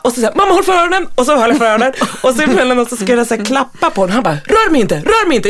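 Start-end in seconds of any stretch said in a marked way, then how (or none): 0.3-1.1 high frightened request; child's plea. Retold or imitated. Frightened son asks mom to cover his ears, high. 4.5-5.5 put-on high, insistent annoyed kid; child's protest. Irritated son tells mom not to touch him, high.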